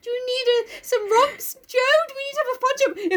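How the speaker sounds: very high, squeaky cartoon rodent; squeaky pet rat; little rat voice. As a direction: offer help in a tiny squeak. Plays the pet rat helping.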